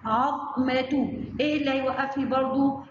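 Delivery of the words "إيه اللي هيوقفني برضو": usual speaking voice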